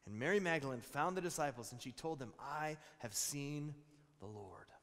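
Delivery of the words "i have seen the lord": slow, fading to a whisper; awed hush. Acted. Mary telling the disciples she saw the Lord, slowing into a whisper. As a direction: let it sink to a whisper.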